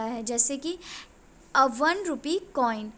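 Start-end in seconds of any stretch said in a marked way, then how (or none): none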